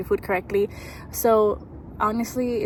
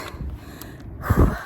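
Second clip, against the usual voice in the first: heavy winded exhale after running; breathless sigh. Real, not acted. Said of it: "I'm catching my breath".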